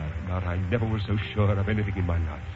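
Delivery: hushed heartfelt vow; tender. Acted. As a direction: say it close and sincere. Tender proposal.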